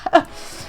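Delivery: short voiceless laugh; real laugh. Real, not acted. Storyteller amused at drunk man's remark, real laugh. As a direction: burst of amusement between words. Laughs at her retold reply.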